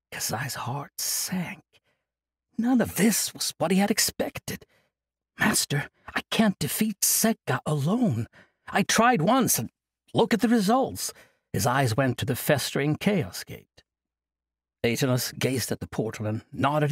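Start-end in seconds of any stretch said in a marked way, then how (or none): 5.4-11.1 put-on higher, strained young voice; desperate plea. Kasai begging the master for help, desperate. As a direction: beg him to understand.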